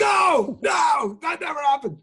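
high, loud panicked protest; father yelps his denial to the accusing son; mock outraged denial. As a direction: deny it frantically, voice shooting up. Denying child's accusation, escalating.

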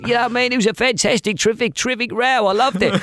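high, put-on voice of a football manager; impression of Harry Redknapp. Impressionist quoting Harry Redknapp on the fight, high put-on voice. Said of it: "And he".

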